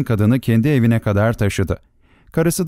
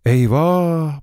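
drawn-out, higher-pitched cry of alarm; dismayed exclamation; 4 semitones higher than usual. A narrator's reading. Narrator reacts to the boy's ugly reflection, dismayed.

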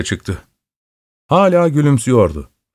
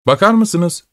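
raised voice; 7 semitones higher than usual. Acted. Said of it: "dedim sesimi yükselterek".

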